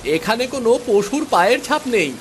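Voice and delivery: put-on high, eager little boy calling his father; a young son tugging at his dad's attention; child voice. Child voice in a cartoon drama. (উঁচু গলায় ছোট ছেলের আবদার)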